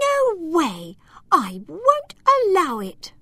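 high, defiant elf voice; furious little elf. Acted. Tiny elf Lila defying the giants, high and furious. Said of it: "Lila was furious".

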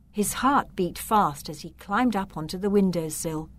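plain reading voice

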